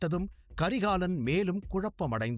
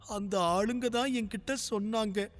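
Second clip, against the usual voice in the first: put-on little girl's voice; 5 semitones higher than usual. A narrator's reading. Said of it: "பிந்து மெதுவாக".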